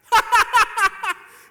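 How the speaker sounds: loud, high, drawn-out "ha ha ha"; mocking laughter. Married man mocking his engaged friend, loud mocking laugh. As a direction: point and laugh at him, big and loud. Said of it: "points, and starts laughing".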